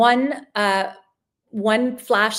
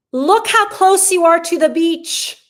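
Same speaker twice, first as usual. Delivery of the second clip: loud, high and slow, selling the view; excited; 7 semitones higher, 8 dB louder than usual. Real, not acted. Host showing viewers the hotel photo, loud and excited. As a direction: gush over how close the beach is.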